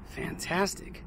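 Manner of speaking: breathy awe. Real, not acted. Quiet awe at the view.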